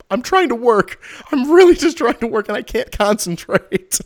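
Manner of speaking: high, loud mock wail of frustration; high loud frustrated wail; exasperated plea. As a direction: whine it, voice cracking into a laugh. Own retold frustrated plea.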